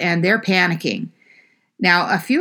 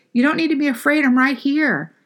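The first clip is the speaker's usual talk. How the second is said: higher, tender reassuring voice; soothing reassurance; 8 semitones higher than usual. Retold or imitated. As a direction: comfort frightened friends in the storm. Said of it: "a way of trying to soothe us".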